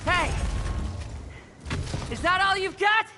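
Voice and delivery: high, taunting battle shout. Acted. Knight taunting his opponent, shouting high. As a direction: goad the enemy with a yell.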